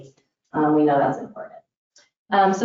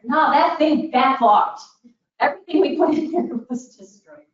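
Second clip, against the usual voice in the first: exasperated outburst; 8 semitones higher, 4 dB louder than usual. Retold or imitated. Retelling hurricane dishwasher disaster.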